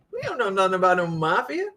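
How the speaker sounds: mock mobster denial. Joking mobster denial repeated.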